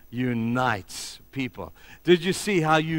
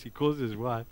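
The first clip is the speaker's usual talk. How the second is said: ordinary delivery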